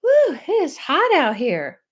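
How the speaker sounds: high, loud mock gasp at the heat; mock overheated. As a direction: ham up someone wilting in the heat. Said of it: "and we're like".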